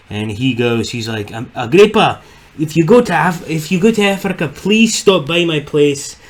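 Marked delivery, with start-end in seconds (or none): 1.5-4.5 higher-pitched attempt at a South African accent. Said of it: "trying to recreate a South African". 4.5-6.2 put-on slow, high, botched South African accent drifting Scottish; Scottish-sounding accent. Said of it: "I'm doing a Scottish accent".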